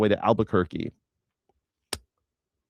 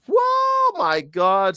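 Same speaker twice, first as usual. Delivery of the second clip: mock awe; 9 semitones higher, 3 dB louder, much slower than usual. Recalling the nude scene.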